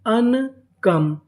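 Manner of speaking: अनकम very slow, as अन, then कम; syllable by syllable. Shown as a demonstration. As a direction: stretch अन, pause, then drop onto कम. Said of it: "तो meaning क्या बनी". (अनकम को धीरे से दो हिस्सों में)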